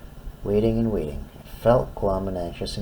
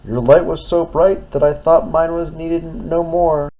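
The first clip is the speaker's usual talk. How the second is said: higher, louder small lighthouse; 8 semitones higher, 6 dB louder than usual. Acted. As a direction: plead in a small voice. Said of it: "said the little red lighthouse".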